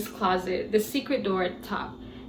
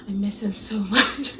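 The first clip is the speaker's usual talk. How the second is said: whisper. Retold or imitated. Voicing Robert's intimate reply.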